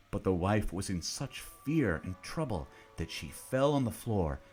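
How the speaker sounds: usual speaking voice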